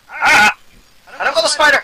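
high, loud frightened cry; panicked yell. Real, not acted. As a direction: yelp as the spider hits. Spider attacking.

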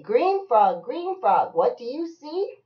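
high, loud singsong refrain; bouncy storybook chant; reading to a child. Acted. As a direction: call the frog, then lift the question. Reading picture book aloud to child.

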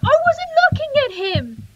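high, loud whine of outrage; indignant protest. Real, not acted. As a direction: protest the unfair attack at high pitch. Protests being attacked.

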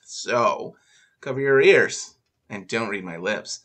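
usual speaking voice

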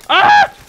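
sudden shriek, very high and loud; startled scream; real shocked shriek; shrill scream. Real, not acted.

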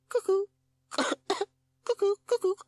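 very high spluttered bird call; coughed cuckoo. A narrator's reading. Rescued pupil on its back, coughing squeaks. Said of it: "it coughed".

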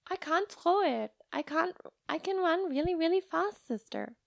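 put-on high childish voice, defensive; little girl protesting. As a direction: pitch it up like a small girl insisting.

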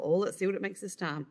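usual speaking voice

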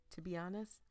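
ordinary talk, nothing marked

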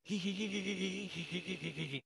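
quiet breathy giggle at own teasing; hushed playful giggle; soft giggle. Acted. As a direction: let a small giggle slip out. Teasing neighbor, laughs it off.

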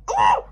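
short loud exclaimed "ooh"; sudden whoop. Acted. Teller's sudden exclaimed sound effect.

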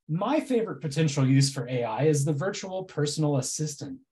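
ordinary talk, nothing marked

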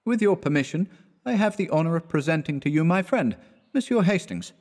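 flustered introduction, pitched high; stammered. A narrator's reading.

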